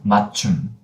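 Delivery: 맞춤 stopped after 맞, then 춤; cut and closed. Shown as a demonstration. Teacher contrasts two spellings, 맞춤 cut midway. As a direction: close off 맞 before saying 춤. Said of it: "This one".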